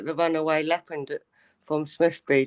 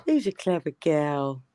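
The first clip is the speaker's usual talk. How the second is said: pet talk. Real, not acted. Cooing at a pet.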